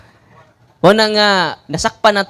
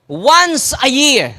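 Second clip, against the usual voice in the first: slow emphatic answer; 5 semitones higher, much slower than usual. Shown as a demonstration. Answering own question to crowd.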